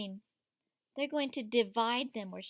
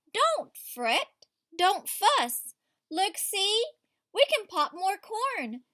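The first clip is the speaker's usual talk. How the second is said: reassuring mouse; 5 semitones higher, 5 dB louder, slower than usual. Acted. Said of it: "Mouse speaks".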